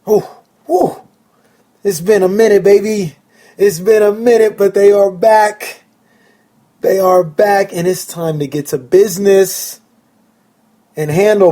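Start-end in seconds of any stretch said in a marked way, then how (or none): 0.0-1.0 excited whew. Real, not acted. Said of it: "hear it in my voice". 1.8-9.8 higher and louder, fired up for the new season; real excitement. Real, not acted. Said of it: "the excitement in my voice".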